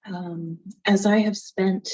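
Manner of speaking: ordinary talk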